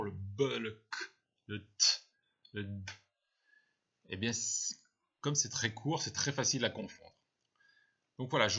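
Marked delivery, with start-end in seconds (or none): 0.0-2.9 B, K, T, D as bare consonant bursts; short letter sounds. Shown as a demonstration. Teacher listing easily confused short sounds, B, K, T, D as pure noises. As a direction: sound out B, K, T, D one by one, no letter names. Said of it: "ces lettres qui ont des sons courts".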